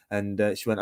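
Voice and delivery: ordinary talk, nothing marked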